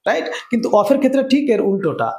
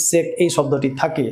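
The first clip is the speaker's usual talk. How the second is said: ordinary talk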